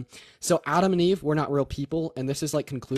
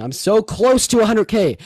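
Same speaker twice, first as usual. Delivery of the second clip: excited plea; 8 semitones higher, 9 dB louder than usual. Real, not acted. Begging chat for follows.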